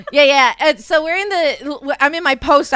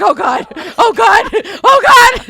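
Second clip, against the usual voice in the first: high, loud laughing panic; hammed-up terrified husband; mock panic; 9 semitones higher, 8 dB louder, slower than usual. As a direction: gasp it like a scared husband.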